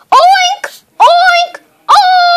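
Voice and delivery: high playful pig oinking; pig oinks. Pretend. Kid playing a racing game, squealing pig oinks. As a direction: oink like a cartoon pig.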